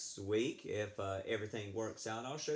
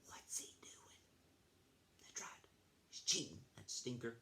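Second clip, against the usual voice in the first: whisper; 9 dB quieter than usual. Real, not acted. Librarian whispering to the children about the cheat. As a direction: lean in and whisper the secret. Conspiratorial aside to the kids.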